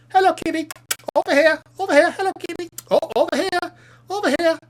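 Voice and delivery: squeaky pretend-mouse voice luring the cat; calling the kitten. Real, not acted.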